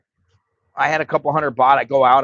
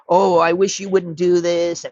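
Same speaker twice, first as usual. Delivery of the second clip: higher, whiny mock complaint; mock wimp; 4 semitones higher than usual. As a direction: imitate a feeble pushover pleading. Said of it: "He's not a pussy".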